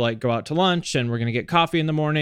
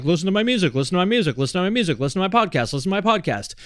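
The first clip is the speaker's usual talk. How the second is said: mock nagging plug; 3 semitones higher, much faster than usual. Mimics a nagging self-promoting feed.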